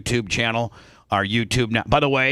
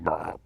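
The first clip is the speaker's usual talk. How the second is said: burp; 8 semitones lower, 8 dB quieter than usual. Retold or imitated. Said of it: "I'd burp".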